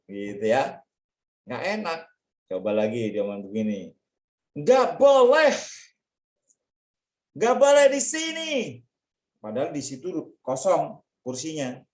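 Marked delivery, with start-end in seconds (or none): 4.5-8.5 high, loud, snapping "not allowed here"; angry refusal. Acted. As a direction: shoo a kid off the seat. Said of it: "temenmu marah begini".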